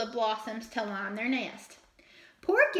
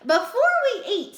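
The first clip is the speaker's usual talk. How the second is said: high, loud, bouncy rabbit voice; rabbits' voice; 10 semitones higher, 8 dB louder than usual. A narrator's reading. Dizzy rabbits answer Fletcher, high and loud. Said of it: "said the rabbits".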